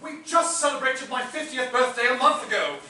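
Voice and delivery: an affronted butler raising his voice; offended outburst. Acted. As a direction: protest being thought old. Butler offended about his age.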